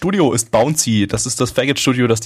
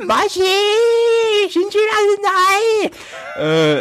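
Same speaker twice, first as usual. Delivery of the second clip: Japanese TV host; much slower than usual. Retold or imitated. Mimicking Japanese TV talk.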